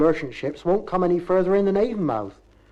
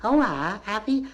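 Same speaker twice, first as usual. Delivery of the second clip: very high, rising Bristol question; Bristolese lady's voice; 6 semitones higher than usual. Shown as a demonstration. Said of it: "I'll have to use a Bristolese speaking lady's voice".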